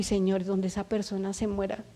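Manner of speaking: hushed fearful prayer; worried murmur. Retold or imitated.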